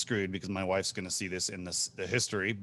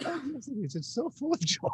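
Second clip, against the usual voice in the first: squeaky high mock reply, softer; mock high girly voice; 13 semitones higher, 6 dB quieter than usual. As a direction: play a dreamy little girl, high and soft. Mocking a singing girl's reply.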